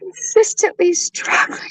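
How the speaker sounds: choked, breathy whisper through tears; barely getting the words out while crying; tearful whisper. Real, not acted. Breaking down over car troubles.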